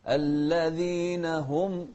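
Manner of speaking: slow, sung recitation; slow sung Quran recitation; melodic Quran recitation. Shown as a demonstration. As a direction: chant it slowly and melodically.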